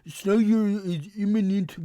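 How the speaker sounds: high, loud, put-on voice of a fan who is crying; mocking whiny fans. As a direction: ham up a whining fanboy. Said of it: "they start crying, being like".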